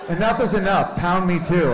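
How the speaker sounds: high, loud mock-earnest declaration; tweeting woman. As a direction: proclaim it like an outraged tweet. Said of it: "it's like".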